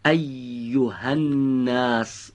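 «ایها الناس» drawn out slowly, with a clear Arabic «ی»; slow example word. Shown as a demonstration. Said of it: "مثلاً".

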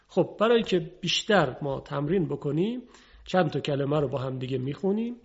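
ordinary talk

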